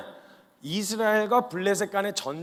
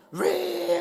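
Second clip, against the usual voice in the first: high strained bellow of a brute; giant's roar; 11 semitones higher than usual. Acted. Goliath shouting at Israel's camp, a high bellow.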